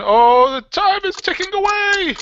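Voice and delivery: hammed-up high, loud wail; mock panic. Host counting down to the deadline, mock panic. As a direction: cry it out high as if time were running out.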